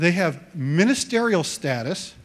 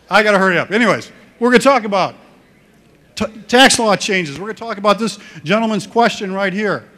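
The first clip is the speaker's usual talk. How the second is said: hurrying on; 2 semitones higher, 8 dB louder, faster than usual. Real, not acted. Rushing back to topic after laughter.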